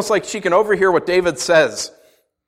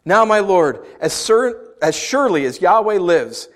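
scripture reading; 5 semitones higher, 4 dB louder than usual. Acted. Reads Abigail's scripture plea.

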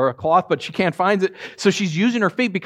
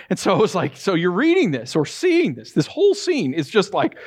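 normal talking voice

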